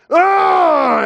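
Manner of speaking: high, loud cry of frustration; a sharp frustrated "Ah!"; frustrated yell. Retold or imitated. Pastor venting at the rejection with a loud frustrated yell.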